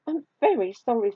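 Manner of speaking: high, pleading storybook mouse; apologetic mouse. A narrator's reading. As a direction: pipe an apology as a small mouse.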